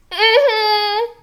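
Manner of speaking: high hum into a loud laugh; high-pitched laughter. Real, not acted.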